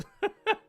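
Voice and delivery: short high burst of laughter; quick loud giggle. Real, not acted. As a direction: crack up briefly, high and loud. Laughs at own jab.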